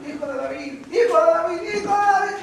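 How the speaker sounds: high call rising from moderate to loud; calling out, building. Retold or imitated. Blind man calls after Jesus walking on, calling out. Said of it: "Me están llamando a mí".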